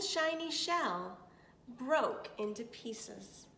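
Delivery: plain reading voice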